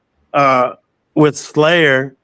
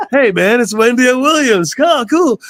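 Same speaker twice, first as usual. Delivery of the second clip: much higher, gushing star-struck voice; mock-excited fanboys, voice pitched way up; 10 semitones higher than usual. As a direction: gush like a fan meeting a star. Slayer guys greet [name] excitedly.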